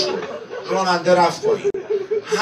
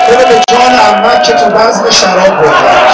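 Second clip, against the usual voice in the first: loud, higher pleading lament; impassioned sermon cry, louder and higher; full-force preaching; 5 semitones higher, 9 dB louder than usual. Acted. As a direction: cry out the oaths to the crowd.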